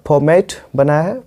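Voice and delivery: usual speaking voice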